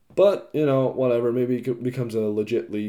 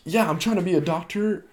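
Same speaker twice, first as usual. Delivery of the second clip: higher, dithering back and forth; scatterbrained dithering; 7 semitones higher, faster than usual. Retold or imitated. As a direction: act unsure between two careers. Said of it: "you can't be like".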